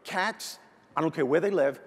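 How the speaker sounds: usual speaking voice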